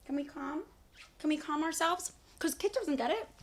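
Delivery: talking to the cat. Real, not acted. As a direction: coax the cat down gently. Coaxing the cat off the table.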